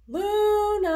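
long high sung-out call across the woods; calling out loud. Acted. Boy calling for his lost bunny friend, loud and high. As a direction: call her name into the trees. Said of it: "He took a deep breath and called".

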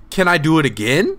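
ordinary delivery

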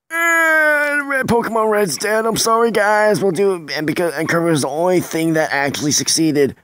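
high, whiny and pleading. Real, not acted. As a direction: whine it like a sorry apology. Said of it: "I kinda had a whiny voice there".

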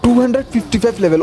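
high, fast, incredulous outburst; shocked disbelief. Real, not acted. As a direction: rattle it off high in sheer disbelief. Shocked by enemy dinosaur's high level.

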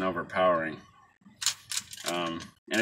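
talking around pipe stem; pipe in mouth. Real, not acted. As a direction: lips clenched on pipe. Seen 0: Pipe stem between lips from -2.0 to 0.6, then removed.

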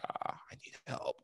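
hushed, breathy plea under the breath; whispered prayer. Retold or imitated. Praying alone at a coffee shop, asking for help, whispered.

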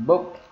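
"Booked" with a crisp T at the end; final T sound. Shown as a demonstration. Teacher repeating "Booked" for the class, final T sound. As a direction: pronounce the -ed of "Booked" as T. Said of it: "Là âm T".

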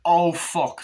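mock exclamation. Reviewer acts out a character's panicked curse. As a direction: blurt it in panic. Imitating fight.

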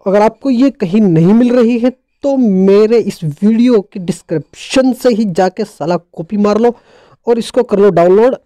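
ordinary talk, nothing marked

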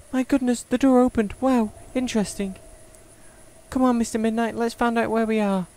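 put-on high-pitched, wide-eyed girl character voice; high girlish character read, intrigued; young game heroine, curious.

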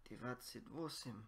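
normal talking voice

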